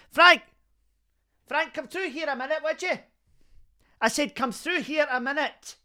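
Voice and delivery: high-pitched call to someone outside the room. Acted. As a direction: holler for Frank to come through. Calling Frank in from outside the studio.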